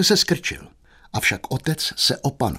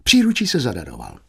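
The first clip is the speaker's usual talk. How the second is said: usual speaking voice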